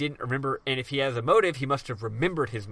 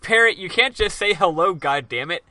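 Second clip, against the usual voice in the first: raised, exasperated scolding; louder, higher start, dropping on the curse; mock exasperation; 5 semitones higher, 6 dB louder than usual. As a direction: scold the parrot in mock outrage. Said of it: "You're like".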